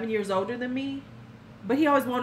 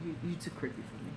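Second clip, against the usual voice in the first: muttered retort; 7 semitones lower, 15 dB quieter, faster than usual. Retold or imitated. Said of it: "I told him, I said".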